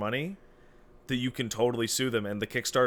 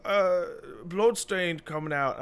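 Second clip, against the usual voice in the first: higher, hammed-up Irish brogue; clumsy Irish impression, sing-song and high; bad Irish accent; 7 semitones higher than usual. Quoting friend John in a bad Irish accent. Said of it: "I can't do an Irish accent".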